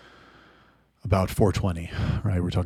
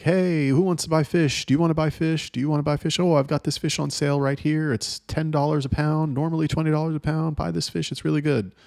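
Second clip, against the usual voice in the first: higher, eager market hawker's pitch; pushy fish seller; 7 semitones higher than usual. Retold or imitated. Fish seller calling to passing shoppers, eager hawker voice. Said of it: "the fish seller people will be like".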